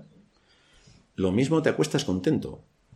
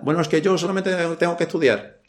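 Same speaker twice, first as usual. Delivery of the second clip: higher-pitched mock excuse; excuse-making student; 6 semitones higher than usual. Preacher mimics a student excusing themselves, mock protest. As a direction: play a student pleading a small duty. Voicing a young person's excuse.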